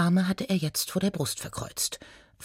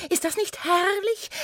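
high, rapturous question; delighted gnome. Acted. Gnome to centaur, delighted and high. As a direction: gush with delight, high and rising. Gnome gushes over a word.

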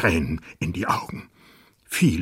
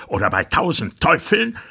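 loud, higher barked order; screaming; 10 semitones higher, 6 dB louder than usual. Acted. Monstrous king orders the dwarf to drink, screaming. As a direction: scream the command. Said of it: "schrie das Ungeheuer".

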